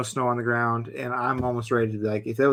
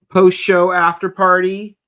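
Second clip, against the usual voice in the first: drawn-out, higher and louder hype; hype announcer; 6 semitones higher, 8 dB louder, slower than usual. Pretend. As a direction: announce it like a party host. Announcing hype after party.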